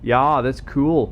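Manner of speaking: slow, loud mock-Canadian accent; Joe Tabasco Fox. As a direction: drag it out in an imitated Canadian accent. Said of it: "he had that sort of accent".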